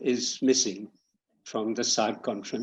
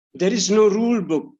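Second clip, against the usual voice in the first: higher, louder false start of the line; 8 semitones higher, 5 dB louder than usual. Real, not acted. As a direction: blurt the line too early, then stop. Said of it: "sorry, six".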